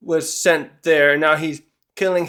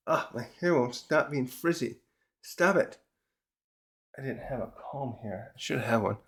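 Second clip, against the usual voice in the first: muttering aside; 3 semitones lower, 11 dB quieter than usual. Real, not acted. Aside fixing frizzy hair.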